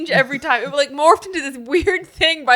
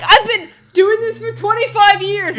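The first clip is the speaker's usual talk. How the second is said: higher, louder, drawn-out exaggerated boast; mock dramatizing; 8 semitones higher, 5 dB louder than usual. Teasing Ryan for exaggerating numbers, mock-dramatic impression. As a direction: imitate someone overdramatizing his own story. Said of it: "he loves dramatizing stories".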